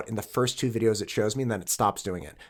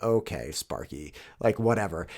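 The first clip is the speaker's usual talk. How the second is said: unimpressed retold whatever; dismissive; 8 semitones lower, 7 dB quieter than usual. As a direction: shrug off a complainer, dropping the pitch. Acted brush-off of angry commenter.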